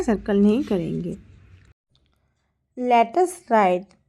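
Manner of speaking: ordinary talk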